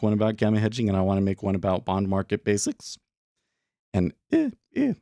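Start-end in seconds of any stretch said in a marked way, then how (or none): 3.9-4.9 cracking voice mid-sentence. Real, not acted. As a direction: voice cracks high on the filler. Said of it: "why is my voice cracking?".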